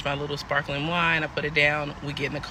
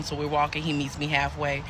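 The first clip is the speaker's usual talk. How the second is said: ordinary talk, nothing marked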